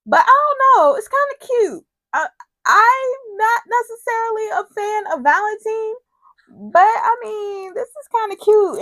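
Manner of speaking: high, loud gushing; excited. Real, not acted. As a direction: gush about the cute couple. Gushing over couple.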